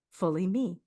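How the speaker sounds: normal talking voice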